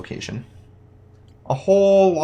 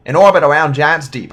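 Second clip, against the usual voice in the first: cowboy voice; 2 semitones higher, 10 dB louder than usual. Acted. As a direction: belt it out like a cowboy. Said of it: "like, a cowboy voice".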